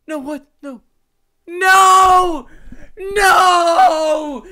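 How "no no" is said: loud frustrated shout of no. Real, not acted. Gamer yelling at his screen in a loud shout. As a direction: yell it out in dismay.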